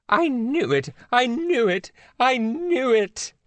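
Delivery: high, gleeful repeated cry; crowing, slowing on the last; triumphant. Acted. The Queen proven right about Italy, crowing.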